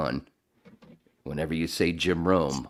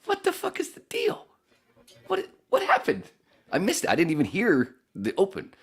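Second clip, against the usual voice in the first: incredulous; 4 dB louder than usual. Retold or imitated. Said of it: "I'm like".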